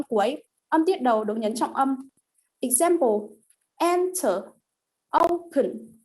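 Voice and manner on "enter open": "enter, open" with a high first syllable, then dropping; first-syllable stress. Shown as a demonstration. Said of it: "the first syllable is stressed".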